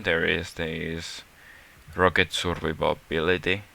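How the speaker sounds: ordinary talk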